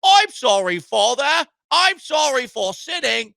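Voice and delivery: mock Cockney apology to father; Cockney accent. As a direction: put on a hammed-up Cockney accent. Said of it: "apologizing to your dad in a Cockney accent".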